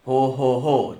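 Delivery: big booming Santa laugh; jolly laugh. Acted. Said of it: "doch dann lachte er".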